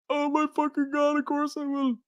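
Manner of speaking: a choked-up, delighted acceptance; overjoyed tearful yes. Retold or imitated. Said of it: "I was like".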